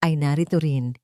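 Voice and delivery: ordinary delivery, nothing marked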